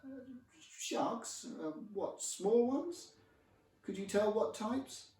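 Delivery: high-pitched, failing attempt at casual; feigned nonchalance. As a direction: squeak out casual questions, badly hiding alarm. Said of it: "The tone of my voice was nonchalant, unfazed, casual-like".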